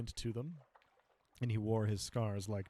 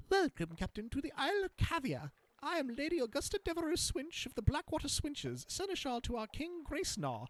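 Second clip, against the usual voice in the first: high, grand courtly rodent voice; guinea pig noblewoman; 12 semitones higher than usual. Acted. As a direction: declaim it like a pompous lady rodent. Guinea pig seneschal's formal greeting.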